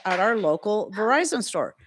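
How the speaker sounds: normal talking voice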